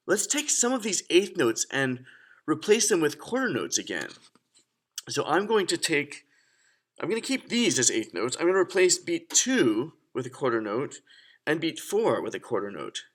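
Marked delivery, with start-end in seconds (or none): none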